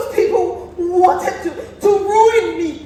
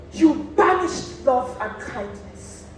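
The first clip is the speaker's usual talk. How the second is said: ordinary talk, nothing marked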